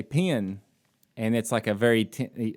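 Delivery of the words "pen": "pen" said like "pee-un", Southern accent. Shown as a demonstration. Southerner shows how his hometown says pen, Southern accent. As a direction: bend "pen" into two Southern syllables. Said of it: "And then we say".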